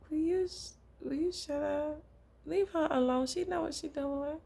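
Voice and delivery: high, brisk shut-up-and-leave-her-alone; exasperated Jesus. Retold or imitated. Jesus rebuking Judas at the dinner, high and exasperated. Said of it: "Jesus was like".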